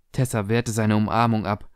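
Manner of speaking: ordinary delivery, nothing marked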